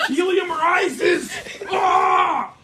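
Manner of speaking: slow, loud drawn-out yell; shouting. Real, not acted. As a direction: bellow it out with a laugh. Excited yelling about helium.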